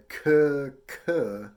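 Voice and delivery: two loud "k" syllables said as "kuh"; K-tongue syllables. Shown as a demonstration. Teacher rehearsing before playing, firm "kuh" for each "k". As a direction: hit "k" hard from the back of the tongue, as "kuh". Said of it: "I'm gonna try".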